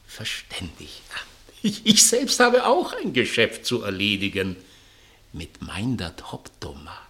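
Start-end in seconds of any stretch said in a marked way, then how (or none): 1.6-4.6 laughing. Acted. Hämisches Lachen über Geschäft.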